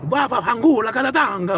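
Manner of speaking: high, hammed-up mock Italian rant; excitable Italian gibberish, imitated; imitated Italian yelling. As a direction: rattle off fake Italian like an angry driver. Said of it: "He was Italian".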